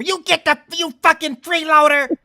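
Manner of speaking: high screaming rage at a freeloader; furious shout. Retold or imitated. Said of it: "Okay, I know".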